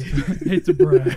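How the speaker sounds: high, slow, loud laughing delivery. Real, not acted. Joking with his friends on the podcast, real laughter. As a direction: break up laughing on a silly boast.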